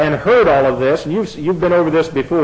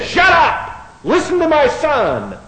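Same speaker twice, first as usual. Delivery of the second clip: shout; 10 semitones higher, slower than usual. Retold or imitated. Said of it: "he says".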